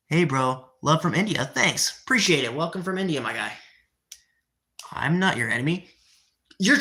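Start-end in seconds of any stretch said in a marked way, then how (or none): none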